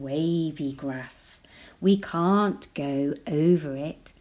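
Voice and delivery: ordinary delivery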